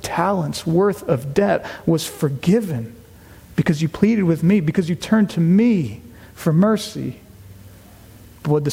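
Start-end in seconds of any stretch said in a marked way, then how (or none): none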